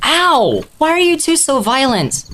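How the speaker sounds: high-pitched, hurt whine at a friend; pained protest. Acted.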